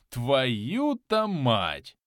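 very slow, drawn-out amazed curse; stunned swearing. Acted. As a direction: stretch it out in astonishment. Stunned by loot, swears to himself. (протяжно, ошеломлённо)

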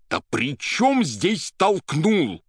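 loud, high, slow and angry; exasperated exclamation. A narrator's reading. Poet fed up with everyone's muddle-headedness, exasperated. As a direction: hammer it out in exasperation. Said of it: "сердясь на общую бестолковость, воскликнул Иван".

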